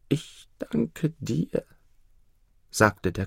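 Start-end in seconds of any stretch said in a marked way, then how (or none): 0.1-1.7 higher, very slow, soft thanks; slow soft gratitude from the sickbed; grateful emperor. Acted. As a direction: thank the bird with quiet relief.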